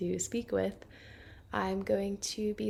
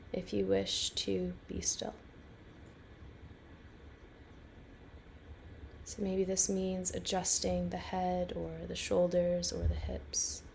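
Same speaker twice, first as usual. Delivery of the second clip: calm, unhurried guiding voice with long pauses; guided meditation. Real, not acted. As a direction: lead a listener gently into rest, phrase by phrase.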